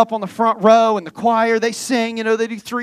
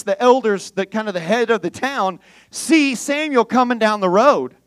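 ordinary talk

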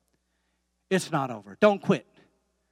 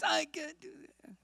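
tiny high-pitched voice, softer than usual; high mock voice; 13 semitones higher, 7 dB quieter than usual. Preacher playfully echoing a Bible promise, high mock voice. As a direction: squeak it out, put-on and high.

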